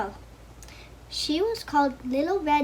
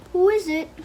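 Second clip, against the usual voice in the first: wolf putting on a granny voice, higher and louder; high old-lady call from the bed; mock grandmother; 5 semitones higher, 6 dB louder than usual. Wolf in bed calling to the door, hammed-up granny voice. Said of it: "trying to sound like grandmother".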